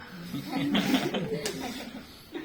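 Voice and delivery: breathy unvoiced laugh bursting out; airy laugh. Real, not acted. Teacher laughing at a student's lunch answer, soundless laugh. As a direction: let a soundless laugh escape. Class laughs after "Hala.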